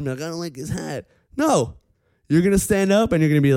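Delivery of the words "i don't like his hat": higher, softer mock grumble; sour puss. Sitting out the [name] song at the club, sour. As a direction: imitate a pouting killjoy. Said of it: "with a sour puss on".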